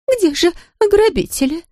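high, faint and scared; weak frightened voice. A narrator's reading. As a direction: ask weakly, afraid. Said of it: "слабым голосом спросила она". (слабым испуганным голосом)

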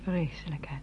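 hushed, mostly breath; whispered remark. Acted. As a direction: whisper it to the others out of his earshot. Hushed talk after boy leaves.

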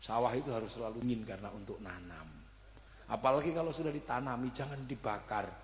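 lightly voiced teacher's counsel. Lightly voiced teacher advising the young husband, calm. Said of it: "dibilang saya".

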